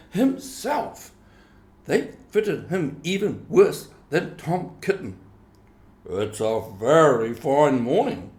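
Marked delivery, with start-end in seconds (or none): 6.1-8.3 a hint of a pompous duck, a little louder; lightly voiced drake. A narrator's reading. Mister Drake greeting the kittens, lightly voiced pompous duck. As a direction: drake remarking grandly, only lightly voiced. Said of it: "said Mister Drake Puddle Duck".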